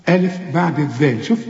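ordinary delivery

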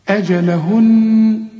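slow أجلهن with a ringing, sustained final nun; doubled nun. Shown as a demonstration. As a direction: hold the final ن of أجلهن in a long hum. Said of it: "نطق النون المشددة عند الوقف".

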